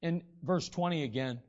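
normal talking voice

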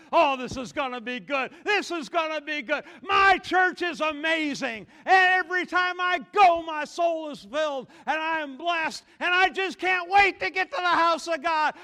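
loud, high preaching shout; exuberant churchgoer. Acted. Churchgoer heading to the house of God, exuberant shouting. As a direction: pile up the excitement phrase by phrase.

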